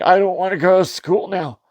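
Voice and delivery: lightly voiced upset little girl; whiny daughter. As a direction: play a kid refusing to go. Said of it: "she's just like".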